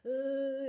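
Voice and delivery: loud, drawn-out shivering "ııı"; imitated shivering noise. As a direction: mimic a child shaking with cold. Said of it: "titriyor mu böyle".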